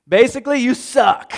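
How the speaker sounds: high, loud scornful outburst; Saul's angry reply. Acted. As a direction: spit it out as a furious king. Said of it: "Saul's reply".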